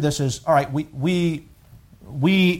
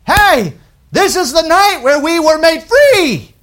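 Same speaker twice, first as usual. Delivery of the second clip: high, loud, slow gloating voice; voice of the flesh; 13 semitones higher, 9 dB louder, slower than usual. Retold or imitated. Preacher voicing the flesh boasting of freedom, high and loud. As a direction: crow it like proud human flesh. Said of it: "the flesh would say".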